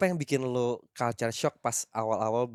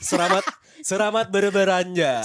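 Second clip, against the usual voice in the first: put-on slow Japanese-accented cashier voice, laughing; cheerful Japanese AI cashier, R becomes r; chipper text to speech voice; 6 semitones higher, 4 dB louder than usual. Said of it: "A I Jepang gitu".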